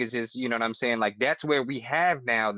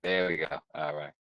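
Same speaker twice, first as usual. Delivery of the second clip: normal talking voice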